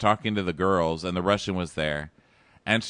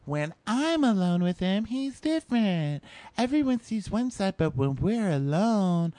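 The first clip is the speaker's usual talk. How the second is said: mocking girl voice; 10 semitones higher than usual. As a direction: parody her sappy excuses in a high voice. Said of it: "She goes".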